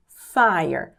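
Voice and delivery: "Fire" said as FY-er, falling; pronunciation model. Shown as a demonstration. Teacher showing learners the word fire, clear pronunciation. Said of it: "A pronúncia".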